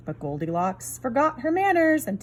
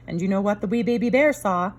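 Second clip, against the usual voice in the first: ordinary delivery, nothing marked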